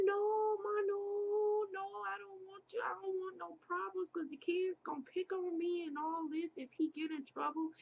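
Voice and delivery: high, urgent begging, easing as it goes on; pleading. Retold or imitated. Daughter begs her mom not to confront the neighbor, pleading.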